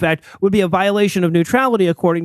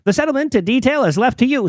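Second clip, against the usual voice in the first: put-on higher, formal old telegram reading; old-timey voice; 5 semitones higher than usual. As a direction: read it like an old newsreel announcer. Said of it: "in an old-timey voice".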